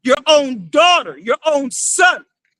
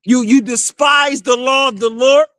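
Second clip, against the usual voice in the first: usual speaking voice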